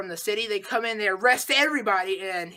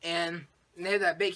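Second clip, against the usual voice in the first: ordinary delivery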